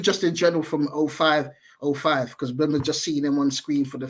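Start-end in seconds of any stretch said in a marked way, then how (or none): none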